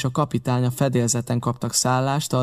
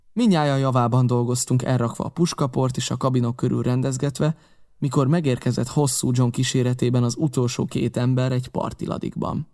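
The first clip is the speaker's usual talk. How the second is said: ordinary delivery, nothing marked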